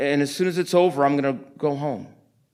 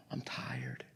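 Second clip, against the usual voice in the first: lower, softer, whispered; whispered weariness; 23 dB quieter than usual. Retold or imitated. A man in the office admitting exhaustion, whispered. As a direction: let it out as a tired whisper. Retold words of a weary man.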